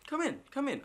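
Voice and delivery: very high, loud mock-British voice; hammed-up British host; bad British accent. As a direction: fumble a British accent up in falsetto. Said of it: "I'm really bad at a British accent".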